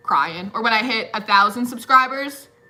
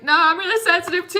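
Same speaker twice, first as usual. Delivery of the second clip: high whiny protest; 7 semitones higher, 3 dB louder, faster than usual. Retold or imitated. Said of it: "and I was like".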